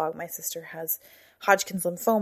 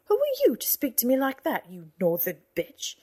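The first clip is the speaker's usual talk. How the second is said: sudden angry outburst, higher and louder; 5 semitones higher, 4 dB louder than usual. Acted. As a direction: snap at her in fury. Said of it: "not amused by the sarcasm and stood up".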